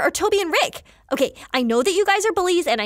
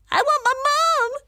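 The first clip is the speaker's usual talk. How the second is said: high mock-crying wail; whimpering bully; 10 semitones higher than usual. Scared bully flees the monsters, high mock whimper. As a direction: wail it like a frightened little coward.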